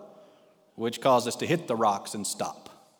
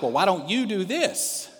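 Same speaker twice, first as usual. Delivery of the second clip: mock yell back at the husband, higher and slower; imitating the wife's higher, slower retort; retold yell, higher; 8 semitones higher than usual. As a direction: throw the question right back, pitched up. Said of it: "she yells to the back".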